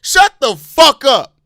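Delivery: loud, high, slow-hit outburst; real exasperated bellow; angry shout. Real, not acted. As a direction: yell it, fed up with complainers. Fed up ranting at fans.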